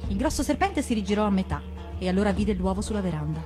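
usual speaking voice

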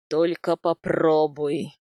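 low, slow, loud menace, not whispered; menacing threat. A narrator's reading. Husband warning his pregnant wife, menacing. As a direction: threaten slowly in a deep man's voice. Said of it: "страшным шёпотом сказал он".

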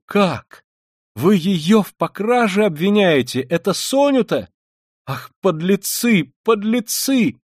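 high, drawn-out indignant cries; outraged outburst. A narrator's reading. Katerina Ivanovna rushing at Luzhin, outraged cries. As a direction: burst out slowly, climbing in outrage. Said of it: "точно сорвалась, бросилась к Лужину".

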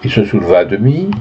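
plain reading voice

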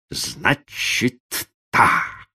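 drawn-out, loaded words with a break between; meaningful drawl. A narrator's reading. Yardman responding to the refusal, drawling meaningfully. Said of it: "многозначительно протянул дворник".

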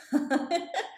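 high rising hearty laugh; loud laugh. A narrator's reading. Mechanic laughing at the driver's joke, loud laugh. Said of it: "ಅದಕ್ಕೆ ಆತ ಜೋರಾಗಿ ನಕ್ಕು".

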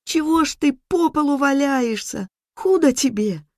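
put-on high, alarmed child's voice; high-pitched distressed calling; girl crying out. A narrator's reading. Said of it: "закричала она".

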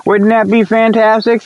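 normal talking voice

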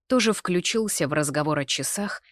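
ordinary delivery, nothing marked

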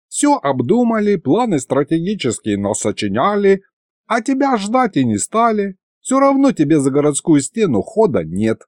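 put-on high-pitched, matter-of-fact old man; old grandfather. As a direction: explain it as a needling grandpa. Narrator voices old Mikhalych.

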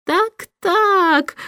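a lightly voiced smirking official; chuckling chancellor. A narrator's reading. Chancellor smirks at the king's lazy answer, lightly chuckling. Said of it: "усмехнулся лорд-канцлер".

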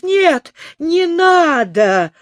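high, slow, begging cry; pleading in terror. A narrator's reading. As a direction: beg for life in panic. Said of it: "закричал Хрумс". (высокая испуганная мольба)

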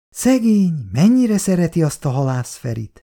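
put-on complaining old wife, mildly put upon; lightly voiced elderly wife grumbling at night. A narrator's reading. Szabó néni's voice.

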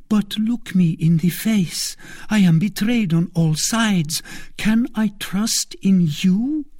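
high, desperate queen voice; searching plea, slowing at the end; anxious queen. A narrator's reading.

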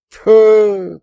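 high, loud scornful puff; dismissive snort. A narrator's reading. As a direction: snort it out with disdain. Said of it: "пренебрежительно фыркнула девушка". (пренебрежительное громкое фырканье)

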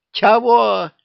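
high, loud shocked squawk; astonished. Acted. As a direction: yelp it in disbelief. Witch astonished question. (изумлённо)

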